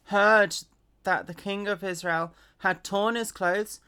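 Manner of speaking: ordinary delivery, nothing marked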